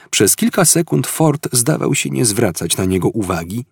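ordinary delivery

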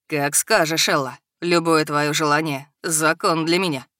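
low, amused devoted rumble; smirking dragon. A narrator's reading. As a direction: grin through the promise. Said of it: "Ухмыльнулся он". (ухмыляющийся дракон)

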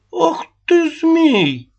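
high, loud outraged cry; flaring up. Acted. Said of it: "Вспылила Аннушка".

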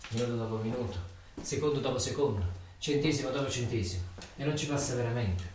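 hushed, low and heavy; low, quiet countdown. Acted. Ritornello cupo del turno. (conto alla rovescia sommesso e cupo)

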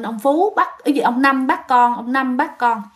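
ordinary delivery, nothing marked

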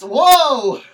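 high, loud whoa; big playful exclamation of surprise; mock shock. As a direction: cry out in exaggerated alarm. Mock shock at co-host's joke.

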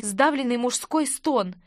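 usual speaking voice

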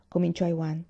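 ordinary delivery, nothing marked

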